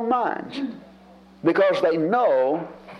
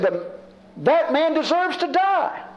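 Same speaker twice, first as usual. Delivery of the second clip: indignant outburst; 7 semitones higher than usual. Retold or imitated. A touch of righteous outrage. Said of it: "David becomes so indignant".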